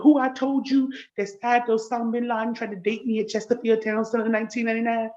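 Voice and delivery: ordinary talk, nothing marked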